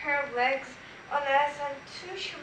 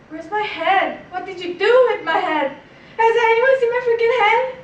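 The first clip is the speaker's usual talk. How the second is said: panicked scream building louder and higher; 6 semitones higher, 12 dB louder than usual. Acted. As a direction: scream it, climbing to a frantic peak. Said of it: "I started screaming".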